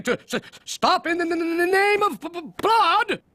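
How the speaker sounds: flustered stammer. Acted. Goblins mock, Plod stammers indignantly.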